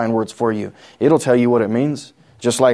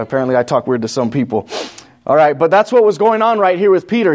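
usual speaking voice

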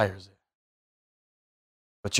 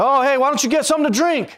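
high, fast, louder mock buddy voice; chirpy friends urging a drink, much higher; 5 dB louder, faster than usual. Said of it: "they say".

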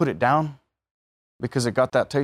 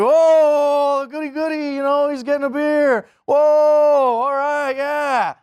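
very high, louder mocking cheer; hammed-up friends celebrating the first beer; 6 dB louder, slower than usual. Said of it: "Everyone's like".

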